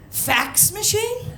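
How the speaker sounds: slow, high, rising disbelief; incredulous. Acted. Comedian reacts to border agent's fax plan, incredulous. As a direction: stretch it upward like a baffled question.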